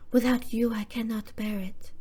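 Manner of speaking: whispered, hammed-up French accent; a deliberately terrible whispered French accent; bad French accent. Annette tells Adam she cannot bear it, whispered bad French accent. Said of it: "the worst French accent I could ever pull".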